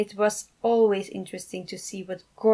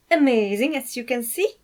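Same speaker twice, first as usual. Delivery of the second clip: showing off; 5 semitones higher, 7 dB louder than usual. Acted. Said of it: "Alex informed him".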